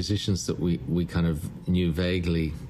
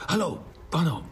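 high-pitched phone hello; retold greeting, pitched well up; imitating Taras; 10 semitones higher than usual. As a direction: act out a frontline soldier answering the phone. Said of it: "Taras is like".

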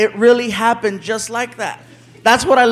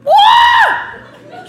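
delighted high yelp. Acted. Neighbor opening the gift basket, high delighted shout. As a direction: shoot up to a high ecstatic shout.

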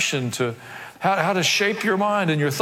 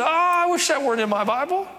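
mock whine; 10 semitones higher than usual. Mock complaint of a listener.

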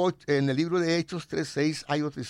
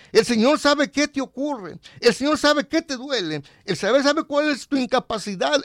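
rising, louder preaching cadence; 9 semitones higher, 5 dB louder than usual. Acted. Preacher assures the listener, louder and higher. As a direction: hammer each line home to the flock. Preacher builds emphatic repetition.

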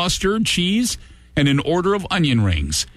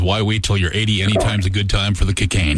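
text read; 9 semitones lower, faster than usual. Acted. Reading a listener text.

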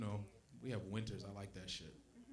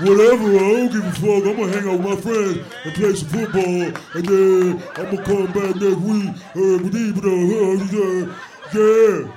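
loud, raised, mocking dumb-guy voice; dopey guy imitation; 6 semitones higher, 15 dB louder than usual. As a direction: bellow it like a caricatured dim boyfriend.

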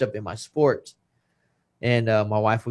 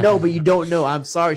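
higher, louder insistent apology; imitating an urgent, pitched-up apology; retold pleading; 6 semitones higher, 5 dB louder than usual. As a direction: plead your case over an interruption.

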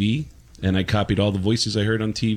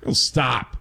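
halting Walken voice with long pauses; slow, broken-up Walken cadence; Christopher Walken impression; 2 semitones higher than usual. Shown as a demonstration. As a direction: stop and start like Christopher Walken. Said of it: "Christopher Walken has got a great stop and go kind of voice".

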